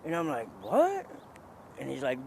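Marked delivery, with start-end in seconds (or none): 0.6-1.1 high, loud startled question; baffled. Retold or imitated. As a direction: pitch shoots up in disbelief. Baffled by the gibberish.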